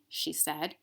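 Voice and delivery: put-on reading a children's book aloud, gently expressive; story narration. A narrator's reading. Teacher reads the Velveteen Rabbit to her class, storybook narration. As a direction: read it like a bedtime story.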